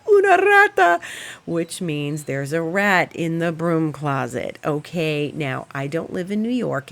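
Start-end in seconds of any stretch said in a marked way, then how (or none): 0.1-1.0 very high, loud Spanish cry of alarm; panicked shriek. Retold or imitated. Cleaning lady spotting the rat, a high panicked shriek. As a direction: shriek it like she just saw a rat. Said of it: "And she says".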